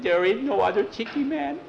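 very high put-on voice; high little character voice, objecting; plaintive Juby character. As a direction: protest in a squeaky high pitch.

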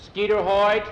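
sharp rise in volume and pitch; stern call-out. Acted. Teacher catches a boy starting early, stern call-out.

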